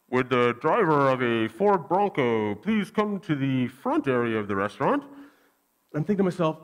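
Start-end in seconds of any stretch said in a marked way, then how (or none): 0.1-5.0 lightly voiced PA announcement, lower; a hint of a waitress paging over the speakers. Waitress paging the owner of the car, mild announcer voice. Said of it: "She's like".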